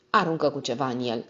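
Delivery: ordinary delivery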